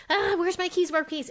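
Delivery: high-pitched, flustered fretting; stressed. Retold or imitated. Mom hunting for her lost keys, stressed. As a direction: work yourself into a mock fluster. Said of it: "And I'd feel the stress".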